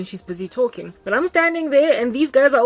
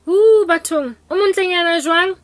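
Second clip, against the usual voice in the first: high, loud cooing mother; gushing mom; 9 semitones higher, 7 dB louder than usual. Retold or imitated. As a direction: coo over the pretty girl, much higher and louder. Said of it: "She's like".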